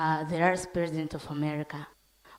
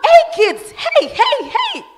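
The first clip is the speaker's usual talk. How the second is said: mom shouting; 17 dB louder, slower than usual. Acted. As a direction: shout over the children's noise. Mother calls over noisy kids.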